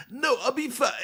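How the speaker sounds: high put-on voice; mock a partner insisting nothing is wrong; squeaky-high imitation of a brush-off; mimicked upset partner. Mimics upset partner refusing to talk.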